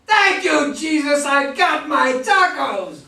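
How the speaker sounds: loud, high, drawn-out cry of joy over the tacos; overjoyed shout, stretching out tacos; triumphant joy. Acted. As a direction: shout it like the best news of his life. Rocco's triumphant final lines in script reading.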